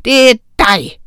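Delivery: loud, punched accusation; angry teacher pouncing on a culprit; roared accusation. A narrator's reading. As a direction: accuse the child forcefully. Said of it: "brølede herr Dummesen".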